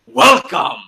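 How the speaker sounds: hammed-up imitation of a caped king; grand costumed king. Drunk caped guy greeting the elevator, grand mock king. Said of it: "with an accent".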